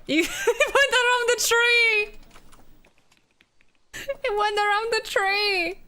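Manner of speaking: high delighted exclamation; amazed excitement. Real, not acted. Amazed at car's move.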